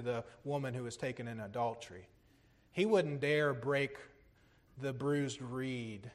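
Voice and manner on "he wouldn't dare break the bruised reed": soft, low, slowed at the start; gentle. Real, not acted. Preacher describing Jesus with repentant sinners, gentle and hushed. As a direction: lower the voice and ease off tenderly.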